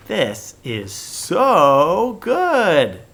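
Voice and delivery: very slow, loud, delighted; excited. Shown as a demonstration. Teacher gushing to show an exclamation, excited. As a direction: stretch it out with joy. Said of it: "you can hear how excited I am".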